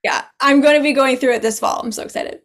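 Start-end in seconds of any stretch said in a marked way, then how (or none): 1.8-2.4 breathy whisper of excitement. Real, not acted. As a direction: whisper it like a secret thrill. Excited aside under breath.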